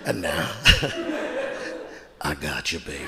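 very low crooning breakdown voice; deep R&B voice. Retold or imitated. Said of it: "He would say shit like this".

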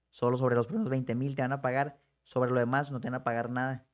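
ordinary talk

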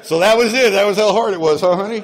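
loud, high, laughing jab at his wife; laughing teasing. Real, not acted. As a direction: tease her through laughter.

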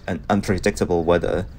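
ordinary talk

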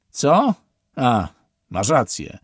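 startled, then shrug it off; surprised then conceding. A narrator's reading. Officer caught out by Steven, surprised then dismissive.

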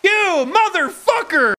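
loud angry yell at another player; furious shout. Real, not acted. Player cursing a rival who betrayed him, furious shout. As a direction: bark it out in real fury.

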